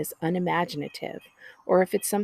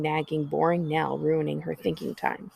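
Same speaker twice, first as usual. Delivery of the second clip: usual speaking voice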